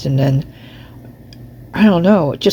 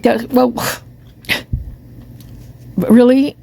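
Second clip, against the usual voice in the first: incredulous; 5 semitones higher, slower than usual. Retold or imitated. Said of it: "I'm like".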